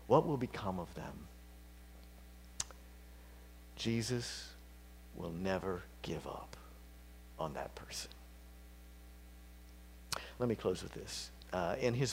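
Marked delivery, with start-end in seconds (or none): 3.7-8.1 hushed whisper, sinking lower; soft intimate assurance to the grieving; whispered promise. Real, not acted. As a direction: whisper it like a secret comfort. Sermon comfort for grieving listeners.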